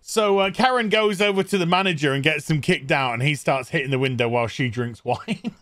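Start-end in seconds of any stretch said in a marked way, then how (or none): none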